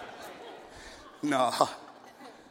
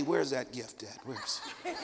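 mock church lady; 6 semitones lower, 11 dB quieter than usual. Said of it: "She cornered me".

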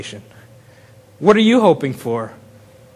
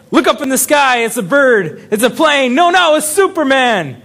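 lightly hammed-up cries of wonder, pitched higher; mock excited crowd; 6 semitones higher than usual. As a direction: play the amazed onlookers. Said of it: "retelling the intro crowd lines".

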